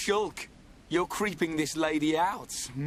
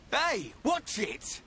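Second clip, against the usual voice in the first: high, drawn-out warning; startled protest; 8 semitones higher, slower than usual. Acted. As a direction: object in alarm, pitch jumping up. Protests at being lashed out at.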